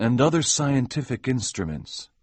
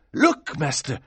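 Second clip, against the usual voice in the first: rising, excited call; urgent servant; 9 semitones higher, 4 dB louder than usual. Acted. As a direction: point and shout to the master. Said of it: "said Aminadab".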